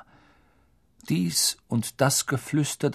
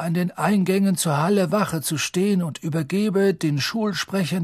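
put-on higher, measured old headmaster addressing the hall; Dumbledore voice; 6 semitones higher than usual. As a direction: speak as the calm, grave headmaster.

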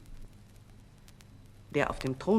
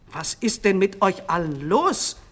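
raised, demanding question; calling out; 7 dB louder than usual. A narrator's reading. King to his staring court, calling out. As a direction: call it out, louder, as a puzzled demand. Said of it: "rief der König".

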